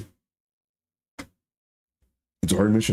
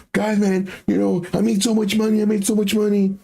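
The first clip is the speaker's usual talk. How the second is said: bragging crypto bro; 8 semitones higher than usual. Retold or imitated. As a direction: brag about lucky gains. Voicing lucky bragging buyers.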